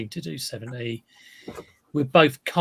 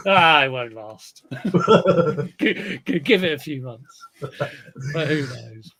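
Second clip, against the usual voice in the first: put-on slow, loud old-timer grumble; lightly voiced weary old reseller; old wizened reseller; 6 dB louder, slower than usual. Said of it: "a voice of, uh, an old, uh, wizened reseller".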